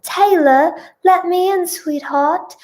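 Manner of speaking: high call, easing on the endearment; pleading call. Acted. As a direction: coax her to open the door. Acted plea through locked door.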